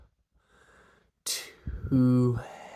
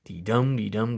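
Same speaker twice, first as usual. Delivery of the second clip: ordinary talk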